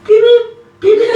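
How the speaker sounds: very high, loud electronic beeps; phone beep imitation. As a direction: squeak like a ringing phone. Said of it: "and he'll go".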